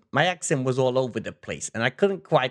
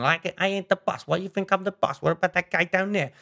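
high, hammed-up mafia gangster accent; play-acting a New York mobster, pitched up; mock gangster accent; 9 semitones higher than usual. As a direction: deny being the boss in a cartoon mobster accent. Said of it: "gangstery type language".

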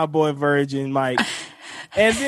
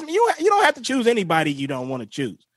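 ordinary talk

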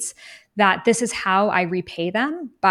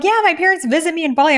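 lightly voiced breezy traveler, high and quick; casual traveler voice; 9 semitones higher, 6 dB louder, faster than usual. Traveler in Bali answering lightly, high and casual. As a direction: toss it off like it's nothing. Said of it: "some of them were like".

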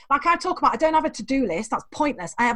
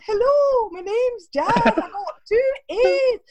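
higher, louder, slow put-on Scottish accent; fake Scottish accent; 10 semitones higher, 3 dB louder, much slower than usual. Student on the phone to a college, faking a Scottish accent. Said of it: "I called Bishop Grosseteste College and I said".

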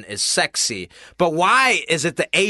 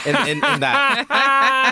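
laughing; 13 semitones higher, 4 dB louder, much slower than usual. Real, not acted. Laughing through joke about himself.

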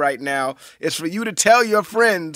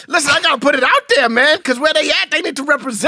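fast hype shout; 8 semitones higher than usual. Acted. Hyping his fans, shouting.

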